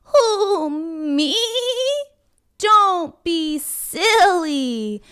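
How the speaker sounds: slow, loud, high goat bargaining. Acted. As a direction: talk the troll out of it like a goat. Middle goat talks troll away.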